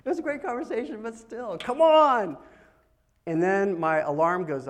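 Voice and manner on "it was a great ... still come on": high-pitched exasperated protest; acted-out frustration, pitched up; irritated. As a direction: grumble in a high voice, then burst out on "come on". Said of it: "I'm irritable".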